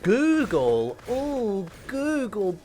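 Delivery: slow, hammed-up seductive croon to Google; mock sultry plea. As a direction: beg Google like a lover, slowly and over the top.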